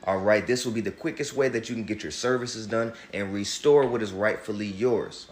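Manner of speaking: normal talking voice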